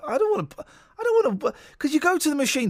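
whiny high protest. Retold or imitated.

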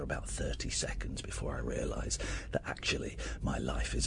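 sinking into a hammed-up whisper; mock despair. Host to co-host, mock existential gloom, fading to a whisper.

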